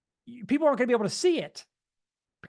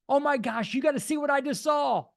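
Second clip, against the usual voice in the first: higher, eager, breathless; excited phone call; 6 semitones higher than usual. Shown as a demonstration. Friend calling about the business, higher and excited. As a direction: gush it like you just struck gold. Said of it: "from your friend that doesn't normally sound like that".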